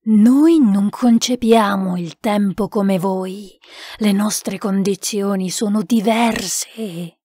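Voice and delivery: slow, drawn-out spirit voice; solemn spirit. Acted. Spirit tells the sitters about time, slow and solemn. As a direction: explain slowly and gravely from beyond.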